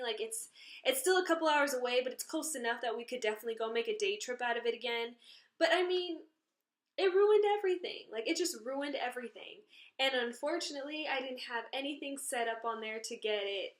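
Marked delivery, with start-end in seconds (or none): 5.6-9.7 upset. Real, not acted. Upset outburst over ruined trip.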